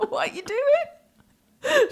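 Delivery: very high, drawn-out squeal of disbelief; re-enacted helpless dismay; aghast through laughter. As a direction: squeak the question out while cracking up. Appalled at bag dropped in bin.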